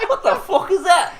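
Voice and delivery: high, loud, laughing disbelief; hammed-up incredulous outburst; mock bafflement. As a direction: demand it like a baffled Bristolian, through laughter. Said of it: "And they were like".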